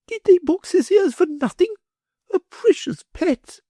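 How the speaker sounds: high, indulgent mother's voice; fussy mother pitying her son; doting mother. A narrator's reading. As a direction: coo over the spoiled boy. Mother's voice held.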